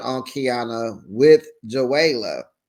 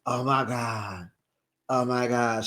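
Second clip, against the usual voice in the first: retold shock; 3 semitones lower, 5 dB quieter than usual. Recapping her reaction to the surprise ring, in imitated hushed shock. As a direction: imitate someone quietly stunned. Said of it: "she was so in shock".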